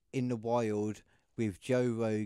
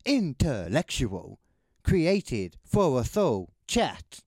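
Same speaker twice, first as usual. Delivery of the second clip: slow, loud, drawn-out proclamation; grand announcer voice; 3 semitones higher, 9 dB louder, much slower than usual. Pretend. Reading AI motto grandly.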